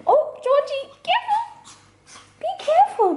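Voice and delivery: very high, cooing caution; doting, squeaky-high mummy voice; pet-talk voice. Real, not acted. Talking to her dog.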